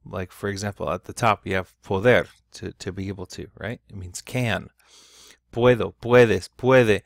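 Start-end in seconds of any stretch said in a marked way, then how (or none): 5.5-7.0 "Puedo, puedes, puede" with each "pue" punched loud; stressed stem. Shown as a demonstration. Teacher reciting the changing forms from the chart, each "pue" stressed loudly. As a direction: hit the first syllable of "Puedo, puedes, puede". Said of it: "The O is not stressed in that case".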